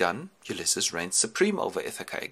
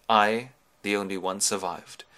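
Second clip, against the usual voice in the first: ordinary delivery, nothing marked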